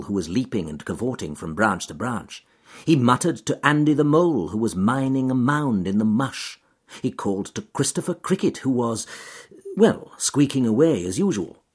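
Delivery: ordinary delivery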